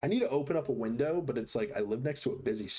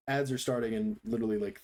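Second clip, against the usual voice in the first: normal talking voice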